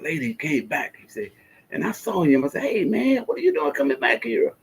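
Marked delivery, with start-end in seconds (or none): 2.6-4.5 high, bright mock surprise; greeting an old coworker in disbelief; surprised greeting. Retold friend meeting, high voice.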